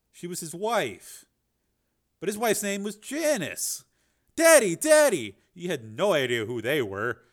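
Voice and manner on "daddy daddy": put-on high, loud child calling; children calling their father in a dream; child shouting. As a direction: call out like an excited kid. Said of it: "called out to him".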